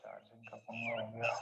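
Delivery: breathy whisper under the breath about the charger; muttered aside. Real, not acted. Teacher whispering to himself about the charging trouble. Aside after long silence.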